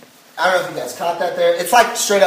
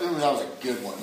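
low, soft, laughing ooh; half-laughing wince at his own slap; mock wince; 8 semitones lower, 10 dB quieter, faster than usual. Dad reacting to slapping his son's hand, laughing softly.

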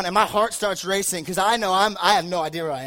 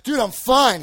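mock tough guy; 4 semitones higher, 6 dB louder, slower than usual. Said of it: "You're like".

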